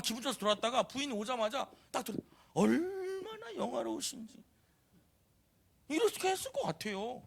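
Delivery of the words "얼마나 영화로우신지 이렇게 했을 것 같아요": high, drawn-out mock praise; sarcastic mockery. Michal greeting David as he comes home, sarcastically. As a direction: sneer it in a high pitch, stretching the first word. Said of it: "막 비꼬겠지".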